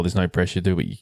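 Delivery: normal talking voice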